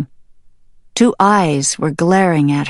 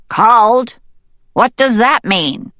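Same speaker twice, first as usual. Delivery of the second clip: higher, louder puzzled question; raised, questioning voice; puzzled mallard; 6 semitones higher, 5 dB louder than usual. Acted. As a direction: query an unknown word in surprise.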